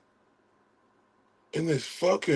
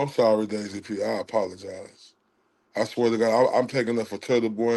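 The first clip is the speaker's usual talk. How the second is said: normal talking voice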